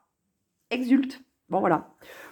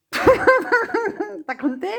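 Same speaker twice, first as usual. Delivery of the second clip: laughed through, slow and much higher; laughing repeat; 6 semitones higher, much slower than usual. Shown as a demonstration. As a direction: let the laugh drag the question out slowly. (en riant, lentement et plus aigu)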